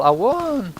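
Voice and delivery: high, drawn-out triumphant whoop; hammed-up victory cry, swooping way up; mock yell. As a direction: yell it like a winner running up. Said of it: "and yell".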